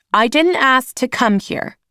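put-on a teenager shouting back at her aunt; girl shouting back at her aunt, angry. A narrator's reading. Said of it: "Naomi shouted at her".

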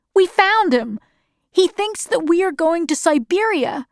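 put-on high-pitched boy with news; excited child. A narrator's reading. Jonas tells Mother about Father and Siberia, high excited child. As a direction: pipe up high like an eager boy.

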